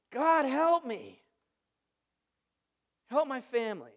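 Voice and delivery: a slow, heartfelt cry for help; pleading prayer. Retold or imitated. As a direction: plead like a worn-out parent praying. Voicing father's repeated desperate prayer.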